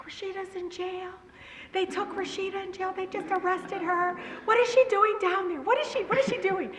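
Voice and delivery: high-pitched panicked mom voice; mock-distraught, climbing wail of worry; upset mother. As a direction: imitate a mother fretting over her daughter's arrest. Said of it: "Yumma is upset".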